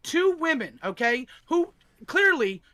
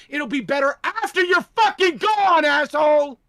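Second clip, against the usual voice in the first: louder, higher furious finish; angry yell; 5 semitones higher, 6 dB louder than usual. Real, not acted. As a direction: yell the final insult. Angry rant climax.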